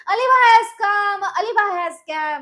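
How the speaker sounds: a loud, slow, high joyful call; joyful shout of arrival. Acted. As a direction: shout the news happily.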